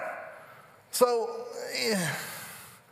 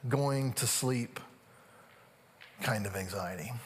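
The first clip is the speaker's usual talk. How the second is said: slow, low, weighty delivery with long pauses; solemn preaching; 7 semitones lower, 4 dB quieter, slower than usual. Real, not acted. Preacher naming an anxiety some know well, solemn. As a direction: let it sink lower and softer, phrase by phrase.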